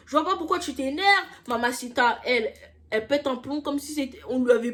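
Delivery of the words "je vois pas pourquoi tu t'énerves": high, louder sneering imitation of the girl; mocking high whine. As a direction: throw her words back in a high mocking whine. Mocking the girl's retort. (imitation moqueuse aiguë de la fille)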